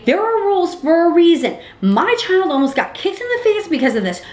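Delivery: high, heated retelling; indignant complaint. Retold or imitated. Mother whose child almost got kicked, indignant complaint. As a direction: protest with pitch pushed well up. Retold scolding in play area.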